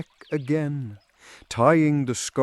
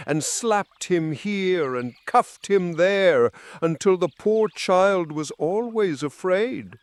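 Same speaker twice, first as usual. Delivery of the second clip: plain reading voice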